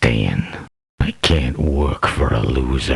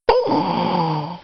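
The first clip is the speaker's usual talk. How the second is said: startled happy shout; surprised cry. Acted. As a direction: yelp it in delight. Merc reacts to a gift.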